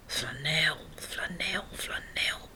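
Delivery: whispered, musing repetition; pondering whisper. A narrator's reading. Director ponders the name, musing whisper. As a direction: whisper it to yourself, thinking. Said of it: "peinst de directeur".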